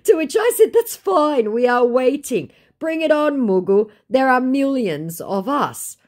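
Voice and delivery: sarcastic shrill taunt; mocking high voice. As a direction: taunt him in a squeaky voice. Mocking scammer reply, very high.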